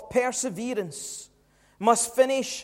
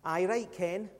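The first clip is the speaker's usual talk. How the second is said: softer, lower east of Scotland doubter; east of Scotland accent; 4 semitones lower, 6 dB quieter, slower than usual. Acted. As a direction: put on a quiet East-Scottish scepticism. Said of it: "east of Scotland accent".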